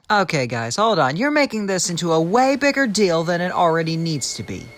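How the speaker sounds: exasperated. Real, not acted. Narrator breaks in, exasperated.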